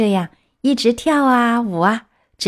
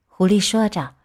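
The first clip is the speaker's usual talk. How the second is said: plain reading voice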